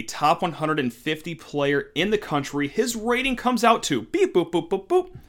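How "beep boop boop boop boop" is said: beep boop computer noises. Pretend. Imitating a computer readout.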